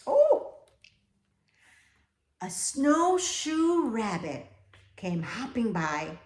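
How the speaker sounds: ordinary delivery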